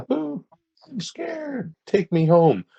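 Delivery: high-pitched mock child's wail; imitated crying child. Storyteller mimics a lost child heard in the woods, crying. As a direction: imitate a scared, crying kid, high and wailing. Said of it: "like, a child crying".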